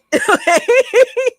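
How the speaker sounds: high-pitched burst of real laughter. Real, not acted. Streamer cracking up at a clip he's watching, high burst of real laughter. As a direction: crack up helplessly, high and loud.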